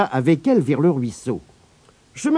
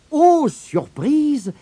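usual speaking voice

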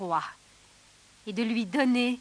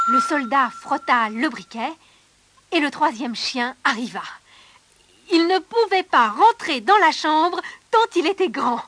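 ordinary delivery

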